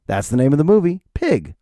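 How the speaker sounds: ordinary talk, nothing marked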